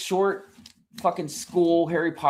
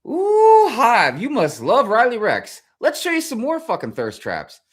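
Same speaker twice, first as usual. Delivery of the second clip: much higher, louder, teasing sing-song; mock algorithm voice; 8 semitones higher, 6 dB louder than usual. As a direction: tease him like a smug machine. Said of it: "the algorithm's like".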